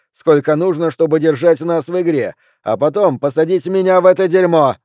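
loud, high-pitched heated rant; aggrieved sore loser sounding off; angry tirade. Acted. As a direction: pile up accusations indignantly. Junior rants that he was cheated.

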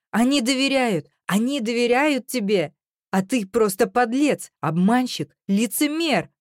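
high, heated string of insults; anguished accusation. A narrator's reading. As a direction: fling each insult at him, upset. She hurls accusations at him.

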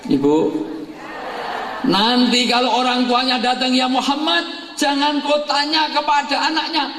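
high call to Muhammad. Acted. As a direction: sing it out high. Jibril calls out again.

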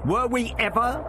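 cold, high-pitched retort. A narrator's reading. Doctor answering his captor, coldly. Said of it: "the doctor answered coldly".

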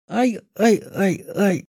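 high pained yelps; cries of pain. Acted. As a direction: yelp as the pain hits. Witch clutching her aching head.

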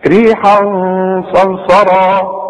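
slow recitation with held ghunna; tajweed recitation. Shown as a demonstration. Teacher demonstrating ikhfa to students, slow recitation. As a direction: say ريحا صرصرا slowly, holding the nasal ghunna before the صاد. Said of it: "خد بالك من الغنة".

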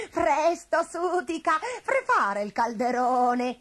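high, urgent call that lengthens at the end; agitated witch. A narrator's reading. Witch ordering Sutica to ready the cauldron, agitated. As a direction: order it in a fluster, starting high. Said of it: "si agitò la strega".

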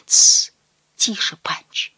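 sharp shush into a whisper; hushing whisper. A narrator's reading. Mother silencing Punch's talk, hushing whisper. Mother hushes her son.

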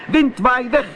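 very high calling voice; called out. Acted. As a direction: cry the command out high to the wind. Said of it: "en riep". (hoog uitroepen)

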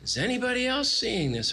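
"Whoa" höher und staunend gezogen; amazed whoa. Retold or imitated. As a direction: stell ungläubig eine Frage an alle. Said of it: "it's like".

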